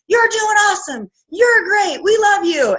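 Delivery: high, bright imitated cheering; cheering viewers. Viewers cheering on a nervous new coach, excited. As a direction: pump them up like an excited crowd. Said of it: "And everyone's like".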